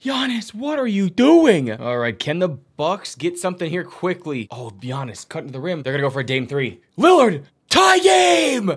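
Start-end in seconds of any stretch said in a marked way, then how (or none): 0.0-1.7 high, drawn-out disbelief at a player; exasperated cry. Real, not acted. Commentator scolding Giannis mid-play, exasperated cry. 7.0-8.7 very high, loud, stretched-out big-shot call; ecstatic shout. Real, not acted. Commentator calling Lillard's tying shot, ecstatic shout.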